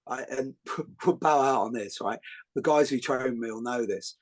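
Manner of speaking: ordinary talk, nothing marked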